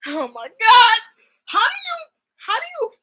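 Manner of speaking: high, loud, laughing shriek; laughing scream. Real, not acted. Fan shrieking at the head grab clip, high and laughing. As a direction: squeal with delight at the clip.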